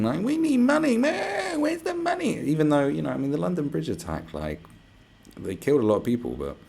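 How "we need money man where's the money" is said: much higher, hammed-up blubbering plea; fake-weep it like a begging official; sarcastic fake sobbing for money; mock crying. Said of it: "crying and weeping".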